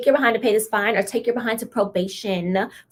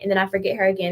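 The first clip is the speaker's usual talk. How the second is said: ordinary talk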